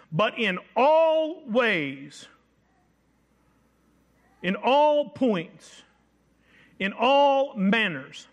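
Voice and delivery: each "all" leaned on slowly and held; slow emphatic build. Acted. Preacher pressing the point to the congregation, slow stressed "all". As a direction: drag out every "all", letting it ring.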